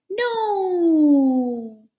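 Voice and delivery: loud, high cry of dismay; shouting. Acted. Elephant sees his ice cream fall, shouting no. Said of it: "And he is shouting".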